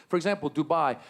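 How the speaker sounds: normal talking voice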